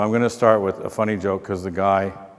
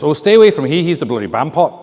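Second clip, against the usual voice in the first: higher, lilting mock Scottish; bad Scottish accent; 4 semitones higher, 6 dB louder, faster than usual. Hotel worker warning about a rival fan, bad Scottish accent. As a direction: badly imitate a warning in Scots. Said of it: "bad accent, I know".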